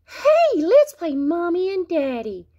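very high, excited squeal; squeaky, gushing little playmate; Frogalina. Acted. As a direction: squeal the invitation, high-pitched. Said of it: "said Frogalina".

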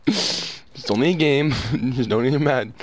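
slow, drawn-out self-soothing; calming oneself. Real, not acted. Gamer talking self down after rage, slow. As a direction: rein the temper in, slowly.

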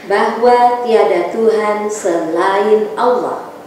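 strong, rhythmic recited pledge; declamatory pledge. Acted. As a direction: proclaim each line firmly. Leads pledge and prayer.

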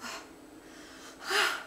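sudden high anguished cry; cry of suffering. A narrator's reading. Cousin cries out in anguish at the refusal, high wail. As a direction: let out a pained wail. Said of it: "Elle poussa une sorte de cri de souffrance".

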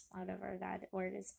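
plain reading voice

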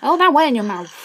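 loud, high exclamation of disbelief; indignant protest. Real, not acted. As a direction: burst out loudly in protest. Protests a disallowed shot.

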